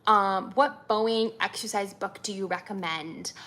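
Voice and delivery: ordinary talk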